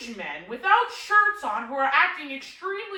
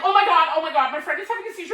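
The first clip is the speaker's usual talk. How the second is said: louder, rushed, acted alarm; mock panic; 4 semitones higher, 4 dB louder, faster than usual. Showing how a worried friend would report a seizure, mock panic. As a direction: blurt it out like an urgent cry for help. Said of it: "I would be acting more like".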